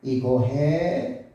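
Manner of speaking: each word stretched very slowly; slow drawn-out speech. Retold or imitated. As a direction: drag the words out like a struggling child. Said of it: "이러면서 말을 느려서 말하기 시작해요". (아주 느리게 늘어지는 말투)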